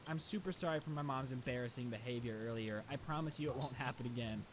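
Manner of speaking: imitated solemn apology. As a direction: apologize formally on someone's behalf, pitched low. Kid apologizes for his mom's behavior.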